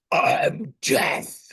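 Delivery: harsh voiceless whisper; Death. Acted. Death introducing himself to an old guy, spooky voiceless rasp. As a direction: rasp it out as the Grim Reaper. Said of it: "and Death says".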